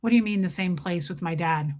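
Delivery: ordinary talk, nothing marked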